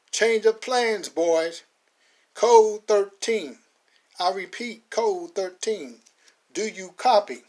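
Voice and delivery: slow, loud drawn-out code call; radio orders. Acted. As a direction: give the code over the radio. Connolly on the radio.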